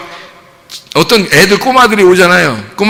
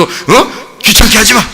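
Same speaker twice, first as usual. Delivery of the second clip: high, bossy mock scolding; acted scolding; 7 semitones higher, slower than usual. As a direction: brush off a nuisance, play-acting. Disciples scolding children. (귀찮다는 듯 높게 꾸짖는 연기)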